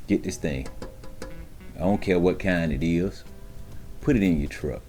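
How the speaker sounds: normal talking voice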